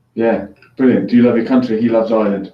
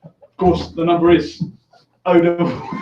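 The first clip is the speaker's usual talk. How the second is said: higher pitch, breaking into real laughter; trying not to laugh; 5 semitones higher than usual. Real, not acted. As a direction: start the number and collapse laughing. Cracks up giving the number.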